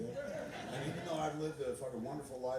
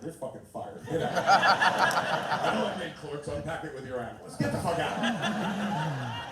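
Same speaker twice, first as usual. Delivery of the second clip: much louder, slow hammed-up boss yelling; mock firing; 5 semitones higher, 14 dB louder, slower than usual. Boss firing a Randall-like employee, hammed-up furious yell. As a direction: bellow it like a furious boss throwing him out. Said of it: "I'd be like".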